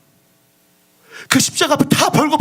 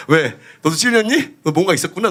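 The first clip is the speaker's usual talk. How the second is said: ordinary talk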